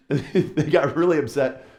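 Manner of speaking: amused storyteller laughing at the memory. Real, not acted. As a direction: talk through real laughter. Laughing at own mock wail.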